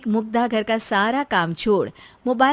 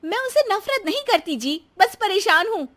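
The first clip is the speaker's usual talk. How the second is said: high, defensive wife's voice; exasperated protest; 10 semitones higher, 4 dB louder than usual. Acted. Wife denying to husband that she hates his mother, exasperated. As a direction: protest with raised pitch, worn thin.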